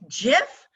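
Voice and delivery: "gif" pronounced like "jiff"; soft-g gif. Shown as a demonstration. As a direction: start "gif" with a J sound. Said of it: "is a peanut butter".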